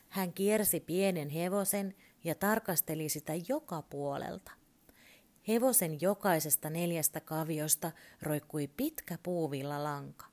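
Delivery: usual speaking voice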